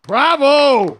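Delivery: loud, high cheer of delight. Real, not acted. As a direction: shout bravo after a great song. Host cheers after the song.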